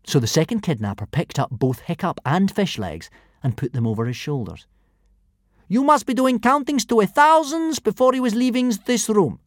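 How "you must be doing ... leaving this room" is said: high loud brute warning. A narrator's reading. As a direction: threaten the class. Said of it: "the first kidnapper warned".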